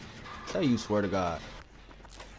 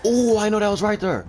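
much higher, louder ooh of relief; excited outburst; 10 semitones higher, 5 dB louder than usual. Real, not acted. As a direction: yelp it out, wide-eyed. Near miss in the game.

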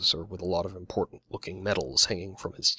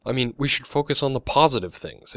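higher, louder upbeat urging; enthusiastic; 5 semitones higher, 5 dB louder than usual. A narrator's reading. Adriana urging her old friends to be positive, enthusiastic. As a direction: push the idea with lifted enthusiasm. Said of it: "Adriana said enthusiastically".

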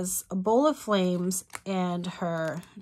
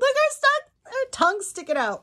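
much higher, louder burst of glee; excited squeal; 4 dB louder than usual. Real, not acted. Showing viewers the goddess picture, an excited squeal. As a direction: squeal as you point at the picture. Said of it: "I'm so excited".

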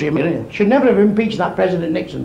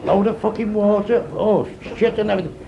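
ordinary talk, nothing marked

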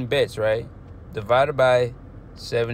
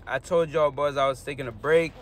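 ordinary talk, nothing marked

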